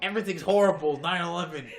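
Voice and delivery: loud, high mock panic; mock alarmist. Imitating a friend who expects disaster, in loud mock alarm. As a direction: cry doom like a hammed-up conspiracy nut. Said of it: "You're instantly the guy who's like".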